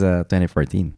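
normal talking voice

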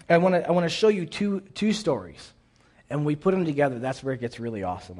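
usual speaking voice

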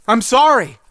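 high, loud desperate plea; shouted apology. Acted. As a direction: yell sorry while running. Pleading while chased by deer.

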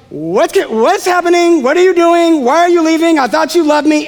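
put-on high, pleading little kid; a child begging its parent not to go; scared child. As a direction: plead like a kid left at the nursery. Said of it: "And so they're like".

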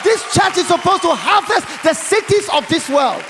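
loud, high preacher's proclamation; full-force preaching. Real, not acted. Preacher charging the church to harvest cities, full-force preaching. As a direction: proclaim it to a big hall, ringing out.

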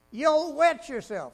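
usual speaking voice

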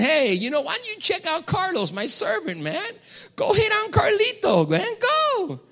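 gleefully sic him on Carlos; mock God egging Satan on. God to Satan, high teasing dare.

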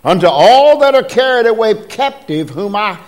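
measured, declaiming King James reading; scripture reading. Acted. Preacher reading Jeremiah aloud to the church, declaiming. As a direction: read the verse with preaching weight.

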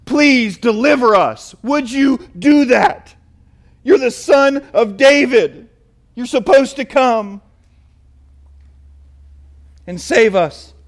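slow, drawn-out begging; crowd's pleading cry. Retold or imitated. Pastor voicing the crowd begging the Son of David, slowly. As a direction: plead with him word by word. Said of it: "here's what they're saying".

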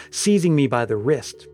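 ordinary delivery, nothing marked

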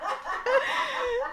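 high, bubbly burst of giggling; real laugh. Real, not acted. Host cracking up at the start, real high laugh. Reacting to a surprise clip.

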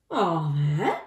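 mildly worried lion voice; gulping lion. A narrator's reading. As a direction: gulp it with a touch of nerves. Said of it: "gulped the lion".

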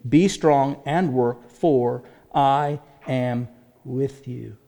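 slow, weighty reading of God's words; preacher quoting God's promise slowly and gravely; slow solemn quote. Acted. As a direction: let each word land slowly. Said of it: "God simply says this".

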